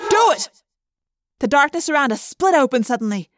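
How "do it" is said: high, loud shout; urgent pitched-up yell; frantic command. Acted. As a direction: order it done this instant. Frantic order to slice it open.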